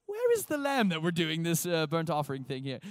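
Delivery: inquiring son in a put-on accent; Isaac. Isaac asking about the burnt offering, put-on accent. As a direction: keep up the playful fake accent, easing off. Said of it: "he has an accent like that".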